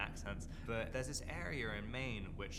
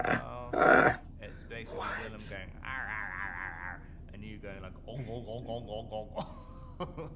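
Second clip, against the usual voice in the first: mock pirate grunts in a lightly put-on voice; a hint of a put-on voice retelling; imitating a friend; 9 dB louder than usual. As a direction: parrot the arr and ong noises playfully. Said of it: "He said".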